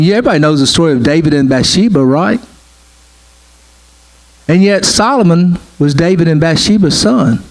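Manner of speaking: normal talking voice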